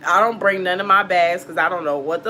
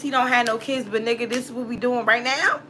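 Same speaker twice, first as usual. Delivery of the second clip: usual speaking voice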